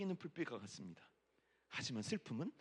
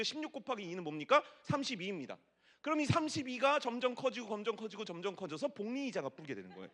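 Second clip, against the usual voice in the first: ordinary talk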